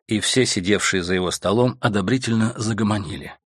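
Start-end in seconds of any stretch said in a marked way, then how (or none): none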